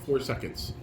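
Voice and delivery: normal talking voice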